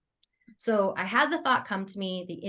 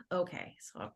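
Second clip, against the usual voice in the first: very soft, reluctant giving in; mock-resigned, barely voiced acceptance; retold resignation; 3 semitones lower, 13 dB quieter than usual. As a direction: give in quietly, energy dropping away. Gives in to nagging inner thought.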